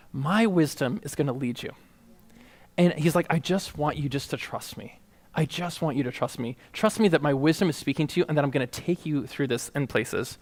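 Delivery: low, very soft, intimate voice; tender reassurance. Acted. God telling Michael to trust his wisdom, tenderly. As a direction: guide gently, hushed and low.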